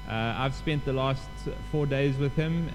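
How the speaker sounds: ordinary talk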